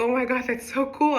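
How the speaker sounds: acting out thrilled amazement; gushing, lifted voice; loving it. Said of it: "I'm loving the dream".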